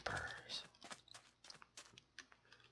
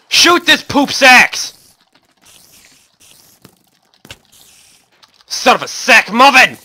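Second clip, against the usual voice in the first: higher, louder, slowed joke swearing; mock-cursing rant; 10 semitones higher, 5 dB louder, slower than usual. Real, not acted. Gamer cursing his bad luck, loud hammed-up rage. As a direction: rage in silly cleaned-up curses.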